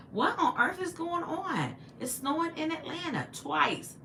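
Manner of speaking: high, slow disbelief; slow, high astonishment at the news; amazed. Real, not acted. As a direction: draw it out in wonder. Reacting to snow news.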